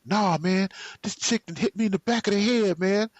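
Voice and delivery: high, fast indignant rant; fuming complaint. Retold or imitated. Telling Rashard she hit him, fast high indignation. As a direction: vent to your boy fast and high. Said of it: "I am fuming".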